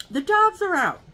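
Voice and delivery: put-on high, loud child voice repeating; little kid insisting. Kid repeating the alarm to the neighbor, high and loud. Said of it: "I hear a little kid".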